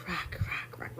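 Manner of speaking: whispered echo dying away; fading echo. Pretend. Singing "my rock" to viewers, whispered fading echo.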